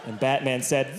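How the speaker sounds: ordinary talk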